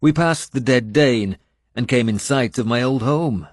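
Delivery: ordinary delivery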